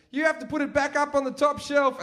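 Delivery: high, sing-out brotherly order; imitating a bossy brother, high voice; taunting older brother. Brother dumps job and leaves.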